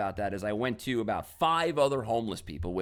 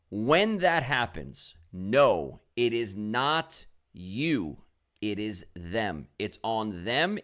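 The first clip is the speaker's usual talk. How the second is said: slow, emphatic advice; slower than usual. Real, not acted. Closing motivational point.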